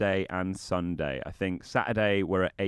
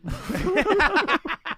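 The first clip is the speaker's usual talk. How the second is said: high burst of laughter; 6 dB louder than usual. Real, not acted. Cracking up at own joke.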